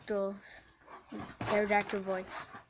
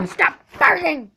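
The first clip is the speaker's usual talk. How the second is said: scolding dog; 4 semitones higher, 7 dB louder than usual. Real, not acted.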